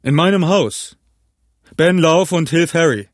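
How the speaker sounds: high, indignant exclamation; calling out. A narrator's reading. Innkeeper sends Ben to help Harry, calling out. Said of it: "rief Silver".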